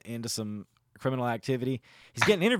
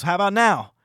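loud, raised-pitch acted threat; threatening challenge; 8 semitones higher, 11 dB louder than usual. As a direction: throw it out as a dare. Acts out man pulling gun.

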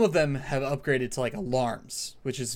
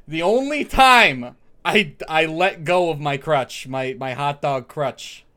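Doctor Joey voice; 8 dB louder than usual. Acted. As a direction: announce yourself like a brash regular. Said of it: "All right, Doctor Joey".

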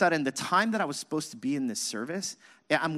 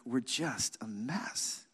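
hushed despair; 4 semitones lower, 8 dB quieter, much slower than usual. Retold or imitated. Nehemiah despairing, voiced.

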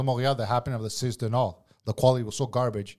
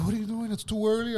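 scolding lady boss; 9 semitones higher than usual. Retold or imitated. Lady in charge questions the cook's timing, high mock fussing. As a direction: imitate the boss fussing, pitched well up.

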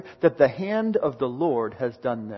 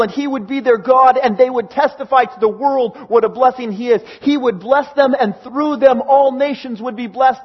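louder, faster preacher building passion; preaching at full force; 7 semitones higher, 8 dB louder than usual. Acted. Preacher to congregation laments war and hatred, louder, fast and fervent. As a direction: press the lament of division home, rising.

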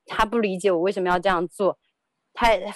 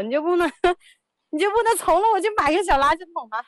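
high, fast, laughing through the plea; 10 semitones higher, faster than usual. Retold or imitated. Wife laughingly pleading with husband over trash bin. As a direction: beg him, cracking up.

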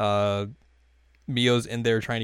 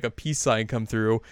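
usual speaking voice

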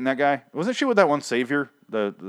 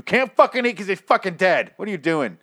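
raised, high-pitched mock rant; exasperated outburst; 8 semitones higher, 3 dB louder than usual. Scolding Rick for leaving food to the dead, exasperated outburst. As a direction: protest in disbelief, pitch jumping up.

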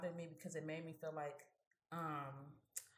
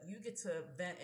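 ordinary talk

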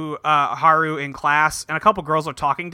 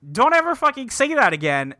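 put-on a touch of a boy scolding a girl, heated; mild acted anger; 8 semitones higher than usual. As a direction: snap the warning with a lifted pitch. Haru rebuking Ron.

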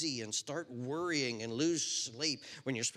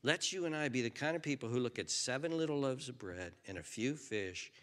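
ordinary talk, nothing marked

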